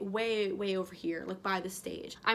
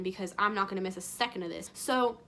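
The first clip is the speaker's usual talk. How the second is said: ordinary talk, nothing marked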